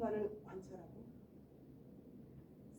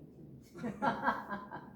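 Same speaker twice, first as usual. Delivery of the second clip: real laugh. Real, not acted. Pastor reacting to listeners' answer, laughing. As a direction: burst of voiceless laughter.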